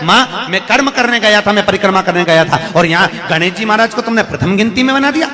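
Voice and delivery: loud, high acted complaint; wronged son crying foul; aggrieved protest. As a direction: protest the injustice to your mother at full voice. Kartikeya protests to his mother.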